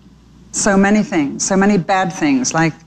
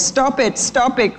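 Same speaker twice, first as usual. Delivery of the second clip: crying out; 6 semitones higher, slower than usual. A narrator's reading. Little birds cry to soldiers hurting Jesus, high understated plea. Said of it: "cried the little birds".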